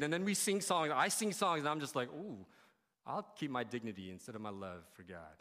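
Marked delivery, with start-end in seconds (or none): 2.1-5.4 low, soft, held-back aside; self-conscious reserve. Acted. Worshipper guarding dignity while others sing, self-conscious reserve. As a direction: shrink back into a quiet mock demurral.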